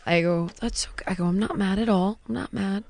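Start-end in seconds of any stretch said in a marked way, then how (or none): 0.6-1.1 very soft, near-whispered comfort; retold soft soothing words; hushed reassurance. Mother reassuring her daughter. 1.2-2.8 whispery, soothing parent voice; retold gentle near-whisper; hushed reassurance. Mother reassuring her daughter.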